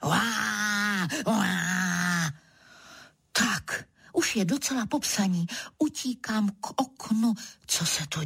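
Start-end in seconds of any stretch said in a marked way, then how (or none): 0.0-2.3 two long drawn-out roars; mock leopard roar. A narrator's reading. Leopard keeps roaring under the window, mock roar. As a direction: roar "waah" twice, held and steady. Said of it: "kdo si pod oknem řve dál".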